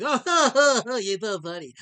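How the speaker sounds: laughing through the words, slow and loud; sarcastic laughter. Real, not acted. Streamer laughing sarcastically at a chatter's joke.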